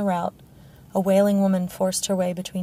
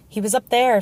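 higher, louder informer's call; shout; 5 semitones higher, 6 dB louder than usual. A narrator's reading. Someone in the crowd to the king, shouting. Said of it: "someone shouted from the crowd".

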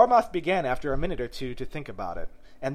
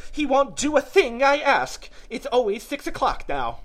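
mournful; 11 semitones higher, 7 dB louder than usual. A narrator's reading. Hatter lamenting that time has stopped for him, mournful. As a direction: lament it in a raised character voice. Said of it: "went on in a mournful tone".

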